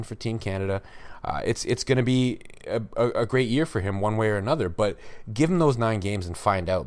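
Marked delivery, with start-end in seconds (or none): none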